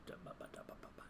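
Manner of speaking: barely audible da-ba-ba; whispered filler syllables. Real, not acted. Player thinking over a French word, whispered. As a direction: whisper nonsense syllables to yourself.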